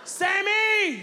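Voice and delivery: high loud call across the store. Retold or imitated. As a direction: shout a kid's name down an aisle. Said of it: "I'm sitting there like".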